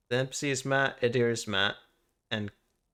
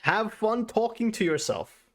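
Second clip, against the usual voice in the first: higher, slow teasing voice; teasing Matt; 9 semitones higher, slower than usual. Retold or imitated. Teasing the GM about talking to himself, high and slow. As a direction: needle him playfully, high and slow. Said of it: "It's like".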